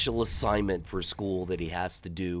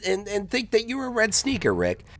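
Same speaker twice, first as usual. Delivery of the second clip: high, louder confused voice; puzzled kid; 13 semitones higher, 4 dB louder than usual. Retold or imitated. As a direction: demand it, bewildered. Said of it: "He's like".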